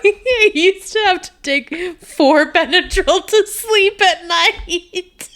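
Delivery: high, slow, laughing confession; laughing through words. Real, not acted.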